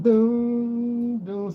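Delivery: high drawn-out vocal doom doom; vocal sound effect of a soundtrack; imitated music sting. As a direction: mimic the dramatic movie music. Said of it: "And they play music".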